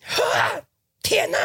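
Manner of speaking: very high, shrieking mock outburst; mock freak-out. Friend yelling at boyfriend who smashed her tea, mock shriek. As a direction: shriek it like a girl losing it at the night market. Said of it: "发疯了".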